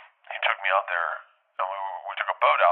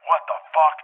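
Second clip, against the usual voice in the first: higher and louder in disbelief; shocked outburst; 9 semitones higher, 6 dB louder than usual. Retold or imitated. Dreamer seeing the steel cage outfit, shocked outburst. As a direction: blurt it out, stunned.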